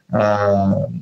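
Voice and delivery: ordinary talk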